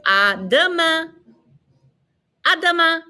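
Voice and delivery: high, held classroom French; repeat-after-me model. Shown as a demonstration. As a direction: lift it high for learners to copy.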